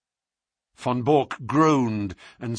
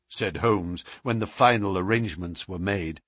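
plain reading voice

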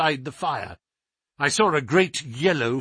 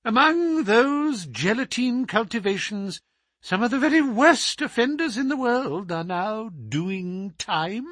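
much higher, smug and self-pleased; 8 semitones higher than usual. Acted. The scholar boasting to the doctor about his microbes, high and smug. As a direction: purr it out pleased with his own wit.

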